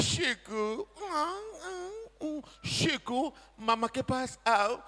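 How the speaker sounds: soft, slow crooning coax; coaxing the dog. Retold or imitated. Mother softly croons to coax her dog over. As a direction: coo gently to lure it over.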